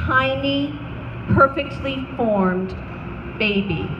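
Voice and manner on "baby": ordinary delivery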